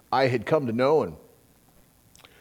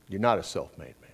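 ordinary delivery

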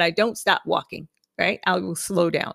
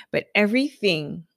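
ordinary delivery

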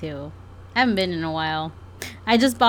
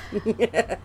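usual speaking voice

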